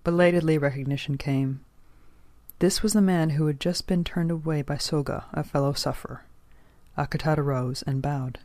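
usual speaking voice

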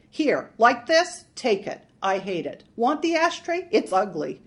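brusque and loud; loud exasperated. A narrator's reading. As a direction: shove it at him, fed up. Marge voiced shoving bowl.

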